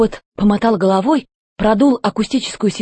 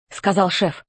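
ordinary delivery, nothing marked

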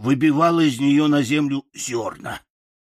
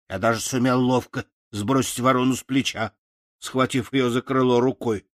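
ordinary delivery

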